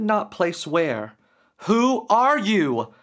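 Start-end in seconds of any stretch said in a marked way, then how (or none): 1.7-2.9 higher, louder, slow deliberate demand; commanding demand. Acted. Derek, now bolder, demanding the entity's name, slow and loud. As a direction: demand it with new found power, slowly. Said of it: "he demanded".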